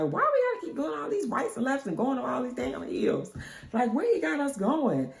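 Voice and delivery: high-pitched, fed-up questioning; complaining. Retold or imitated. China complaining about all the turns, exasperated. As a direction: gripe like an impatient passenger.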